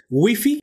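"Wifi" said the Spanish way, as "güifi"; Spanish-style "Wifi", WEE-fee; Hispanicized pronunciation. Shown as a demonstration. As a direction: say "Wifi" with two "ee" vowels, drawn out. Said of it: "está bien dicho".

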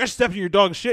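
loud, high mock outrage; acted complaint. Imitating a man confronting the dog owner, loud mock indignation. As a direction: complain loudly as the wronged passer-by. Retelling an angry movie confrontation.

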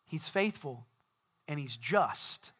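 usual speaking voice

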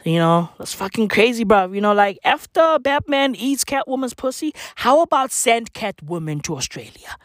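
usual speaking voice

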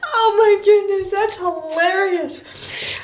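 high, tearful with laughter; real helpless amusement, slowing as it goes; laughing hard. Real, not acted. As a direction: gasp it out mid laughing fit. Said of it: "I'm laughing so hard".

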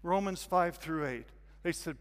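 ordinary delivery

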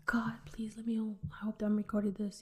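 soft unvoiced plea under the breath; whispered prayer. Real, not acted. Checking her recording, whispering a quick prayer to God. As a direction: pray it quietly to yourself.

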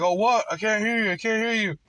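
high, teasing mimic of the man playing deaf; mocking the man. Mocking the man in the truck, high and teasing. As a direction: pitch it up and taunt like he's pretending not to hear. Mocking the man's feigned deafness.

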